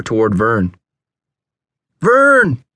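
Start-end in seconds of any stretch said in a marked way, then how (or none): 2.0-2.6 high, loud call of a name; urgent warning holler; alarmed shout. A narrator's reading. Alarmed call about burning.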